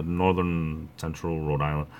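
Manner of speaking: usual speaking voice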